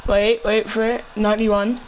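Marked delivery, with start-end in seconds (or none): none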